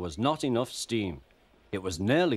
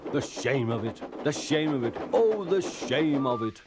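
grumbling; 5 semitones higher than usual. A narrator's reading. Gordon ashamed of goods train, indignant grumbling. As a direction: bemoan the disgrace, pitch climbing with each repeat. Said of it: "he grumbled".

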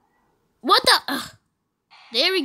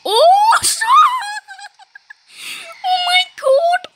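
very high, loud squeal of shock; laughing shriek; 14 semitones higher, 5 dB louder than usual. Real, not acted. Player reacting to the game, laughing shriek. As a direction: shriek through real laughter.

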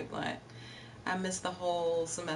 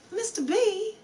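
excited greeting; 13 semitones higher than usual. Real, not acted. Greeting chat viewer excitedly.

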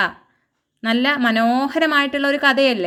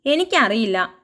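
usual speaking voice

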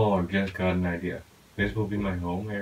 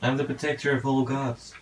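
raised, hammy declaration of a self-made hero; mock heroic proclamation; 6 semitones higher than usual. Gamer proclaiming himself to a skeleton, hammed-up heroic voice. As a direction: announce yourself like a mythic guardian. Sudden grandiose proclamation to mob.

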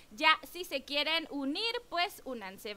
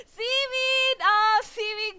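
laughing delight; 7 semitones higher, 9 dB louder, much slower than usual. Real, not acted. Sees a viewer's avatar.

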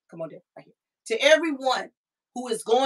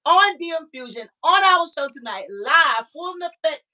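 higher, rising with joy; excited; 7 semitones higher, 4 dB louder than usual. Real, not acted. Host proudly introducing her cousin live, excited. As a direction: let the excitement lift your voice.

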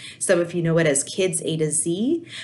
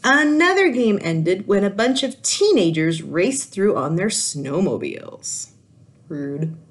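put-on lively read-aloud voice for kids; animated children's story reading; storybook narration; 5 dB louder than usual. A narrator's reading. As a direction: tell it like a picture book to a class. Teacher reads picture book aloud.

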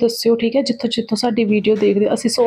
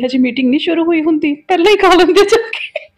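loud urging; 6 semitones higher, 4 dB louder than usual. Retold or imitated. Retold urging to husband.

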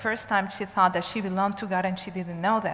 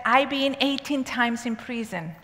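usual speaking voice

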